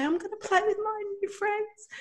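put-on high, eager child voice; excited little girl. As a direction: say it like a hopeful five-year-old. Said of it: "she went to school".

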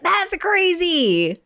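slow high retold astonishment; drawn-out disbelief. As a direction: stretch it in mock amazement. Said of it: "I was like".

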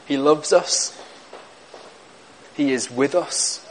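soft devotional. Real, not acted. Soft devotional close after scripture.

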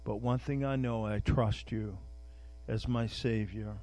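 soft, low prayer voice; preacher closing in prayer, soft and low. Acted. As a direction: pray quietly to God. Leads a prayer.

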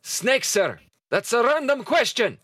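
high gruff sailor voice; Dugald voice. Acted. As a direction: answer the captain smartly. Dugald answers captain.

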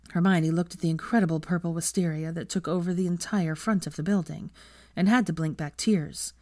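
ordinary delivery, nothing marked

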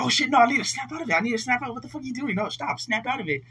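fast, high, panicked inner voice; urgently talking yourself out of a daze; frantic self-talk. Retold or imitated. Panicked self-talk, trying to snap out.

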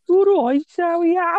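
high-pitched mock Brummie greeting; Brummie accent. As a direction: put on a squeaky, exaggerated Brummie.

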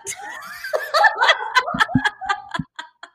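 high burst of real laughter; big laugh. Real, not acted. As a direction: let a loud high laugh break out. Laughing at the story.

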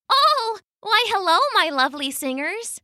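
very high, friendly otter voice. Acted. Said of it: "said a friendly otter".

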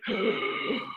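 sharp, high intake of breath; mock horrified gasp; retold shocked gasp. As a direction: gasp as if taking words back. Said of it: "and I was like".

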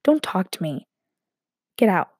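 ordinary talk

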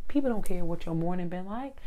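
ordinary delivery

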